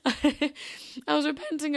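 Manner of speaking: giggling through the words, pitch high and loud; laughing through it. Real, not acted. Admitting to listeners she was repenting, with a laugh. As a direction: laugh sheepishly while confessing. Embarrassed admission while laughing.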